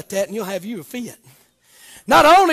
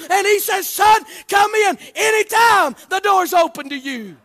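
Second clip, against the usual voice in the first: shouted, higher preaching; preaching at full force; 7 semitones higher, 4 dB louder than usual. Acted. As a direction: call it out to the church.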